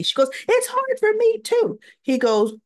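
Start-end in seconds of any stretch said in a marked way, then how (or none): 0.5-1.8 high-pitched imitation of a hurt protest; plaintive protest. Molly answering T J that it's hard, plaintive. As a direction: push it up high, pleading for sympathy.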